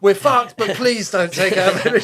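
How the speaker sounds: high, loud begging; a major pleading with the bomber crew; urgent plea. Retold or imitated. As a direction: plead to spare the village.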